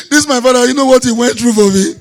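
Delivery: put-on tearful lament; mock crying. Man mourns theatrically when school fees are due, mock crying. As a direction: wail it like a fake mourner. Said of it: "Now I start cry".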